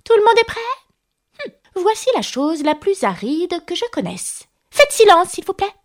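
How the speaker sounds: high, officious mouse voice; self-important mouse. A narrator's reading. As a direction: pipe up pompously, calling for silence. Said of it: "reprit la souris d'un air important".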